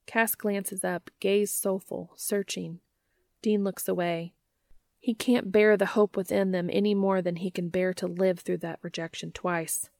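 ordinary delivery

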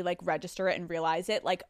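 ordinary talk

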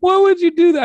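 high, loud disbelief; incredulous yell. Retold or imitated. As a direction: shout it in disbelief. Mock outrage at shitting.

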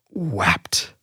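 low and soft, heavily weighted; quiet grief. Real, not acted. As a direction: let the word sink with sorrow. Quiet, low, heavy word.

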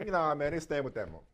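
low, soft, laid-back delivery; casual inflection. Retold or imitated. Host shows the harmless, relaxed version of the line. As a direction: say it easy, just shrugging. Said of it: "Yeah, he's like".

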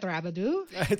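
Rabadou said the French way; French accent. Shown as a demonstration. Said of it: "throw that French accent in there".

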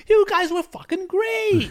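high, loud squeaky stooge voice; Curly voice. Retold or imitated. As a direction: bellow it like Curly. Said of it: "he starts doing Curly from the Three Stooges".

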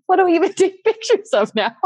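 high, laughing mock complaint; exasperated. Friend with camera who missed the proposal, exasperated. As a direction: wail it in comic exasperation.

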